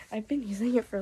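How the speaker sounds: voice giving out. Real, not acted. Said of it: "my voice will last".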